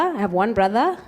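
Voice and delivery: normal talking voice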